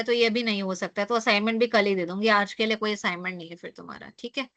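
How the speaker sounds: ordinary talk, nothing marked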